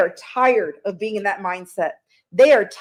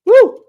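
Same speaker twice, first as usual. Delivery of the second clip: high, loud whoop of delight; joyful woo; excited whoop; 11 semitones higher, 10 dB louder than usual. Real, not acted. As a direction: let out a sudden cheer. Sudden cheer mid-reading.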